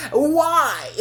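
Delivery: high laughing cry of why; laughing shout. Real, not acted. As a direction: burst out laughing in protest. Laughing outrage.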